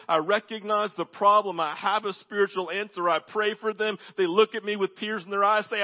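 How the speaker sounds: ordinary talk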